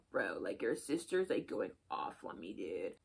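boy complaining about the sister, low mock bro; imitating the boy. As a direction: drop the voice low like a laid-back guy. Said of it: "So he was like".

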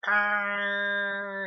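high, loud revving chainsaw roar; mock chainsaw snarl; chainsaw noise. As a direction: rev it like a chainsaw, mouth only. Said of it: "making chainsaw noise".

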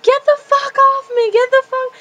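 put-on high, loud drunken screech; drunk yell. Telling how she fought the helper, loud drunk shriek. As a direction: shove him off, shrieking. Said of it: "I was like".

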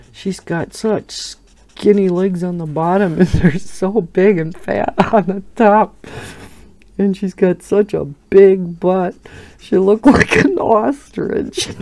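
giggly retold gossip; laughing through the quote. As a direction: voice climbing and breaking into giggles. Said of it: "And I laughed so hard".

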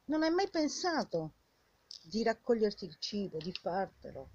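usual speaking voice

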